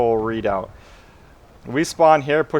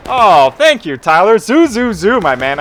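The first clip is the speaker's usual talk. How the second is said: excited; 8 semitones higher, 8 dB louder, slower than usual. Real, not acted. Excited thanks to chat donor.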